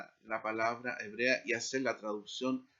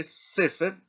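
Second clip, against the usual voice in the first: "sefer" stressed on SE; stress on first syllable; 5 semitones higher, 4 dB louder than usual. Shown as a demonstration. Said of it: "se acentúa en la primera sílaba". ("sefer" acentuado en la primera sílaba, SE)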